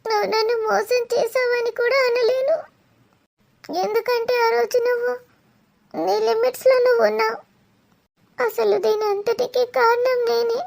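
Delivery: crying, breaking voice, pleading with an absent lover; tearful lament. Acted. Abandoned woman begs her lover Dheeru not to leave, crying. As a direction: let the sobs break through the words.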